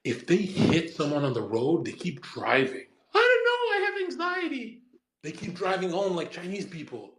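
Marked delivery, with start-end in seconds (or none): 3.2-4.8 very high-pitched mocking squeal; exaggerated high anxious whine. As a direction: squeak it out as a panicky excuse. Mimics anxious drivers, mocking.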